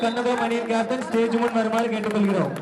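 steady public address announcement; announcer. Acted. Announcer declaring the winning team to the crowd. Calls captain to the stage.

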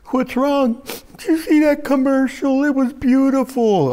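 high, teary mock voice; weepy tired person. Preacher mimicking an exhausted weepy person, high and teary. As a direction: mimic someone crying at a commercial.

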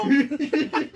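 high, laughing begging; laughing plea. Real, not acted. Friend begging the reader to stop, high laughing. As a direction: beg to stop through laughter.

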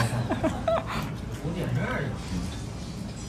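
long soft laugh; hearty laugh. Real, not acted. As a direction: laugh it off warmly. Laughs at husband's face.

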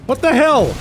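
high, loud, stunned; shocked. Real, not acted. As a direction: blurt it out in disbelief. NPC headbutts him.

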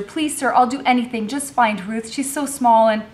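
desperate pleading. Acted. Girl begs for her sister.

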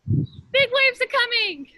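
put-on calling out commands to a room of kids; game caller. Leader running Captain's Orders game with kids, called out.

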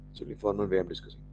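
normal talking voice

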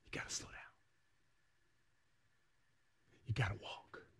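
hushed whisper. Acted. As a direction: drop to a breathy whisper to draw them in. Sermon drops to hush.

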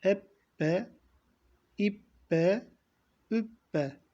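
Ebbe, İbbe, Übbe each with the b held double; doubled b drill. Shown as a demonstration. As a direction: chant the doubled b syllables. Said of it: "Bakın".

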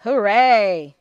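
very loud, gleeful "Hooray!"; squeaky cheer. Acted. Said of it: "squeaked Molly".

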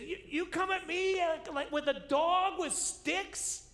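high, slow, loud giant taunt; preacher voicing Goliath's mockery, high and loud; Goliath mocking. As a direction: sneer down at the little shepherd.

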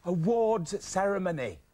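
AWARDS CEREMONY slow and deliberately mouthed; spelling it out. Acted. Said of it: "Watch my lips".

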